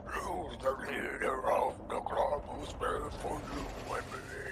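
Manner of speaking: slow whispered zombie chant. Pretend. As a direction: breathe it out like the undead. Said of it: "they have to do it in, like, zombie voices".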